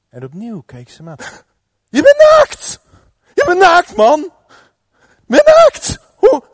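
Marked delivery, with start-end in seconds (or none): 1.9-6.4 very high, loud panicked scream; shocked shriek. Acted. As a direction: shriek in horrified discovery. Schrik bij ontdekking naaktheid. (hoog gillend van schrik)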